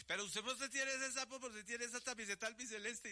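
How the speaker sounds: lightly acted high-pitched challenge; mock questioning. Joke teller voicing the question about the toad, high mock challenge.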